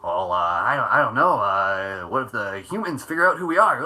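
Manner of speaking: halting squinty-faced sitcom alien impression; hesitant, goofy worrier voice; French Stevens. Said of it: "French Stevens".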